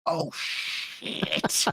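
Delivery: mock whisper of dismay; a caught-out hushed curse. Baller caught out, whispered panic.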